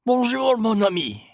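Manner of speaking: cheerful Belgian-French hello; Poirot's French-accented bonjour. A narrator's reading. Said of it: "the well-known voice said".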